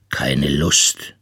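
low, listless voice; lustloses Zitat. A narrator's reading. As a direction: quote a listless child, pitched low. Bear quotes his listless sick son. (lustlos und tief)

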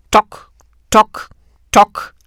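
loud hollow tock tock knocks; knocking sound. A narrator's reading. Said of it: "das seltsame Geräusch".

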